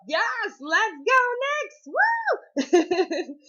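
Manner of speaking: high, loud cheer ending in a laugh; excited cheer. Real, not acted. Fan celebrating the winning streak, cheering loud and high. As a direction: whoop for your team.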